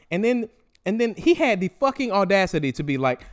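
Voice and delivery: ordinary talk, nothing marked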